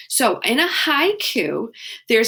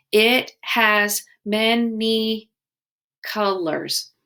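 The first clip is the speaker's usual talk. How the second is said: It has many colors said very slowly; slow syllable count; much slower than usual. Shown as a demonstration. Teacher composing a haiku line with kids, slowly. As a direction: tap out each syllable slowly. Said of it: "we need one more syllable".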